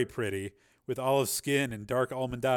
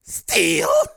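loud, higher, rising question; startled disbelief; 6 semitones higher, 12 dB louder than usual. Acted. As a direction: echo the name in shock. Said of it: "his eyes growing wide".

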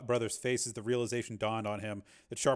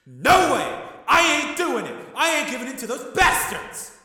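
much higher, louder furious yelling; furious shouting; 10 dB louder than usual. A narrator's reading. Tommy shouting at his brother, furious yelling. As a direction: scream defiance. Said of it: "shouted Tommy".

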